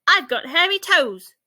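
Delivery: loud, high cheerful shout. A narrator's reading. Ike shouting to the giraffe from a branch. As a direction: belt it out like a proud little monkey. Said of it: "shouted Ike".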